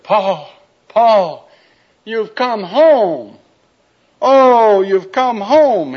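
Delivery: high, loud and drawn out with joy; joyful reunion. Acted. Michelle greeting Paul after ten years, high, loud and slow. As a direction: cry it out as she leaps into his arms.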